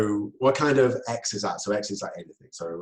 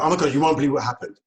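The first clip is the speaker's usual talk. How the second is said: fast, louder, breathless with news; excited gossip; 4 semitones higher, 7 dB louder, faster than usual. Retold or imitated. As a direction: blurt it out like you can't hold it in. Friend bursting with news.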